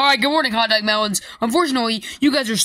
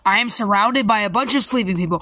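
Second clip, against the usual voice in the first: normal talking voice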